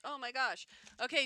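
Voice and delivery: usual speaking voice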